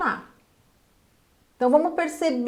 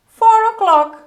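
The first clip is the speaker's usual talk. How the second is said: "Four o'clock" said higher and louder as the wrong version; mock wrong answer; 9 semitones higher, 9 dB louder, slower than usual. Shown as a demonstration. Teacher mimics a student's wrong answer, higher and louder. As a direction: toss out "Four o'clock" as a learner's mistaken reply. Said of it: "como que você vai falar?".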